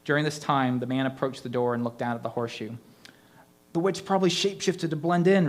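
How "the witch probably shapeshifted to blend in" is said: a wheezy, higher line; wheezed. A narrator's reading. Rhonda exhausted from pumping her gun, wheezing. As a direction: push it out out of breath. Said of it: "Rhonda wheezed".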